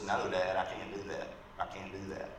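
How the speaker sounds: soft, low mock-tearful boy, fading; retold tearful plea. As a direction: plead quietly, only lightly imitating a crying son. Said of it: "his son starts crying and says".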